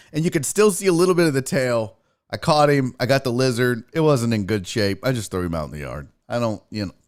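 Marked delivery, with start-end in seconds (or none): none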